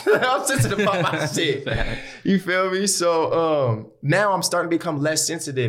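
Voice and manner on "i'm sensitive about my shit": laughing it off, higher. Real, not acted. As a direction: say it through a laugh. Laughs at own confession.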